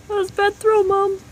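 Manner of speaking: high teasing voice for the dog; dog's voice. Pretend. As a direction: speak for the dog, high and cheeky.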